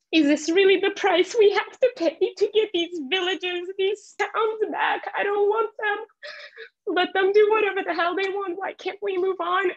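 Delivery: tearful quote pleading with family at home; tearful plea. Real, not acted. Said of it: "I'm getting emotional".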